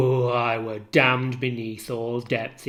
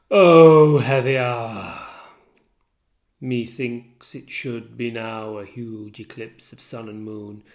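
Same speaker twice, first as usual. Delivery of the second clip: normal talking voice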